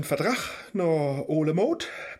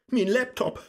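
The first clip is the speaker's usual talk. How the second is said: outcry; 9 semitones higher, 4 dB louder than usual. Acted. Faust cries out in despair.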